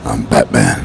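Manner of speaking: deep, low Batman growl again; gravel-free low hero line. Retold or imitated. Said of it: "funny when he said that on the movie".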